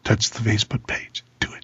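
low breathy creepy whisper; heavy breathing phone whisper. Pretend. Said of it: "Wow, creepy [name]".

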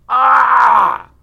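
loud, high, long scream of pain; mock agony yell. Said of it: "You yell out in agony".

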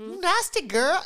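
high, loud, drawn-out teasing; teasing friend voice. Retold or imitated. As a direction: squeal it like mock outrage. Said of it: "I'd be like".

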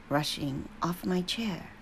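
usual speaking voice

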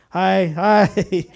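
high, loud, drawn-out greeting; calling from afar. Retold or imitated. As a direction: hail a stranger gently from a distance. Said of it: "from afar off 'cause I didn't wanna scare her".

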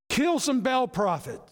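loud, high, gung-ho order; mock command. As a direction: call it out like a battle order. Mock order expected from God.

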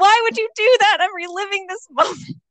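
high, loud whiny cry of a hurt fan; anguished mock wail. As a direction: wail it like the spoiler just stabbed you. Mock fan outcry at spoilers.

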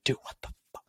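a hushed, appalled what the fuck; whispered disbelief. Retold or imitated. Host watching kids skip her house, a hushed appalled whisper. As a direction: breathe it out in disbelief. Her own thought, quietly indignant.

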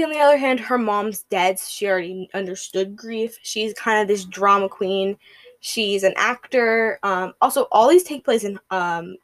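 usual speaking voice

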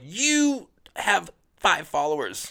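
high, drawn-out retort; slow, hammed-up put-down, pitched high; mock scornful comeback. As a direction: stretch each word in playful disbelief. Acting out comeback to online hecklers.

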